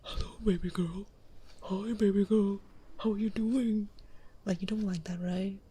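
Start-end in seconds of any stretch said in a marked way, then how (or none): 0.0-3.9 mock real-voice flirt in an altered timbre; voice changer off. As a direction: put on a smooth-talker voice. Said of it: "Voice changer off".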